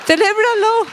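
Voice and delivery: high, bright call of Celébralo; a client hearing Celébralo as a joyful cheer, high pitched; gleeful celebration. Retold or imitated.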